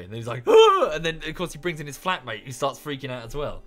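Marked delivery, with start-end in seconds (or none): 0.5-0.9 sudden shrieking scream, much higher and louder; imitated scream. Streamer imitates a gamer's jump-scare reaction with a loud high scream.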